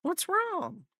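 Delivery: high pet-talk question. Real, not acted. Baby talk to dog.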